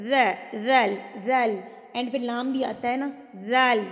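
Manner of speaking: ذل with tongue touching teeth, soft. Shown as a demonstration. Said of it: "نرمی سے پڑھا جاتا ہے". (ذل کو نرمی سے، زبان دانتوں سے لگا کر)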